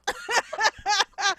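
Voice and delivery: high, loud burst of laughter. Real, not acted. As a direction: burst out laughing after venting. Laughs after exasperated outburst.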